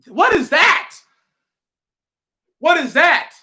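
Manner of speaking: high-pitched, punchy mock disbelief; incredulous. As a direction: react as if appalled by such talk. Preacher reacts to the people's complaint.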